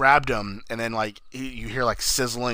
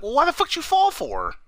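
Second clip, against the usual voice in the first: high, incredulous complaint; mock outrage at a dumb movie choice; exasperated disbelief. Retelling a film scene, scoffing at the mother for falling. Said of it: "And I was like".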